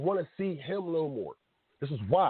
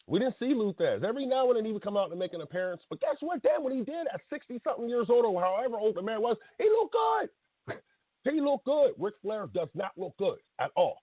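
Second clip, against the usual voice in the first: worked up; 7 semitones higher than usual. Real, not acted. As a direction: get carried away arguing your point, voice climbing. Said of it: "I'll calm down".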